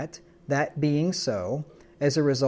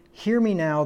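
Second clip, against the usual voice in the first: raised, pleading hear me now; urgent appeal, higher and louder; urgent call; 6 semitones higher, 4 dB louder than usual. Shown as a demonstration. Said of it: "he's using this to express emotions".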